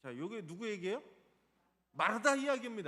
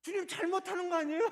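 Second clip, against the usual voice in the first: higher and louder, demanding answers from the Lord; heated complaint, pitched up and pushed fast at the end; indignant accusation; 7 semitones higher, 6 dB louder than usual. Acted. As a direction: accuse the Lord of doing wrong, rising outrage. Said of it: "이렇게 얘기하는 거예요".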